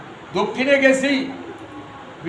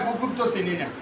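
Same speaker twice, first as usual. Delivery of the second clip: puzzled villager; 4 semitones lower, 9 dB quieter, faster than usual. Retold or imitated. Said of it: "পুকুর কইলে কয়".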